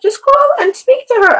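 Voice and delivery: very high, loud imitation of advisers; insistent mock advice. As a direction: quote them in a high, singsong push. Acted advice of group members.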